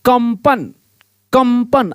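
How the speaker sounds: कंपन with a clear m before the p; audible m sound. Shown as a demonstration. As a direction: close the lips on m in कंपन, for learners to hear. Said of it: "म का जो कि उच्चारण आ रहा है". (कंपन में म की ध्वनि साफ़ बोलते हुए)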